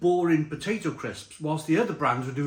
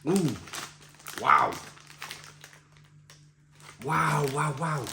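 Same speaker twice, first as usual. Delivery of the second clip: impressed wow; slower than usual. Real, not acted. Reacting to tasting crisp.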